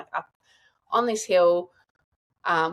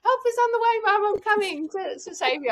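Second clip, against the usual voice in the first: Mrs Doubtfire voice. Retold or imitated. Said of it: "have you seen, um, Missus Doubtfire".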